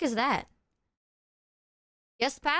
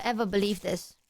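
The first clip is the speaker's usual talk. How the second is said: ordinary talk, nothing marked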